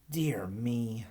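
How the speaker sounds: whispered whiny sigh; whined whisper. Acted. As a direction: breathe it out in a whine. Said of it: "whined Aggie".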